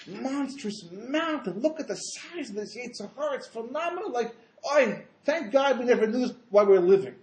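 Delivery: hammed-up wail of disbelief, swelling at the end; mock tearful awe. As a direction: imitate the righteous overwhelmed to tears, stretching the words. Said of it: "And why the Tzaddikim crying?".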